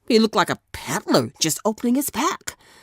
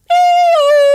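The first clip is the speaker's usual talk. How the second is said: very high, loud whistle-like cry; a shrill whistle calling the team; 10 dB louder than usual. A narrator's reading. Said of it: "to his team gave a whistle".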